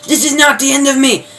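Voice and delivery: high, loud vow of revenge; hammed-up vengeful yell at the government; vengeful mock shout. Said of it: "um, vengeful. She's like".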